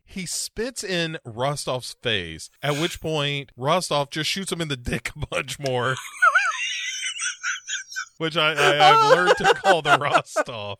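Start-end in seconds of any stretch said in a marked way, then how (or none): 6.0-8.1 high shrieking burst of laughter. Real, not acted. Laughing at absurd movie scene.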